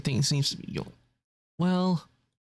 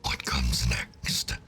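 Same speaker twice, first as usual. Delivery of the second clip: slow whispered demon voice; demonic voice; much slower than usual. Pretend. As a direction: hiss it slowly like a demon. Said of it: "in demonic voice for?".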